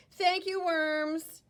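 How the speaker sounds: put-on high, sweet little girl; girl thanking the worms, high and sweet. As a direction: thank them brightly like a girl. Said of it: "little girl is thanking".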